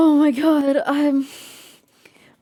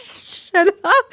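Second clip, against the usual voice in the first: laughing; 7 semitones higher than usual. Real, not acted. Laughing at game character's line.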